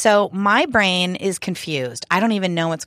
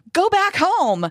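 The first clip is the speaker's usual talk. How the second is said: high shouted command to a dog; imitating a woman yelling at a dog; yelled command; 9 semitones higher, slower than usual. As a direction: order the dog home, much higher. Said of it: "she's giving the command".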